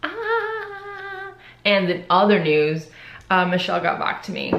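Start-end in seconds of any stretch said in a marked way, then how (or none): 0.0-1.3 excited squeal. Real, not acted. Gets to eat rice cakes.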